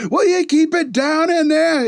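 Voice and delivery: high, slow put-on complaining lady; annoyed neighbor lady. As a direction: whine it through the wall as the neighbor woman. Neighbor lady banging on wall.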